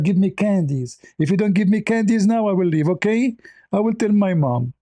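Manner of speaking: imitating a child who wants candies now; whiny kid. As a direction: whine like a spoiled kid threatening to leave. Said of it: "you're acting like a kid".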